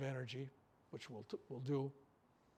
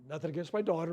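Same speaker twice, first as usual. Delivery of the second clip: normal talking voice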